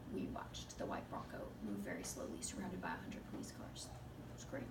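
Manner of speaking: whisper. Real, not acted. Wry recollection of slow chase.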